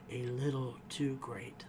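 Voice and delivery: conspiratorial whisper; hushed insinuation about Barb; whispered aside. Retold or imitated. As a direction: breathe it out as a sly secret. Mariner's suspicious quip.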